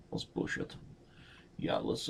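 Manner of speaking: unvoiced whisper to yourself; whispered self-talk. Real, not acted. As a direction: hush it under your breath. Whispering while playing.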